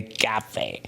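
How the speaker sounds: "cafe" in a soft, put-on French accent; mock French accent. Shown as a demonstration. Comedian echoing the name of a French cafe, mock French accent.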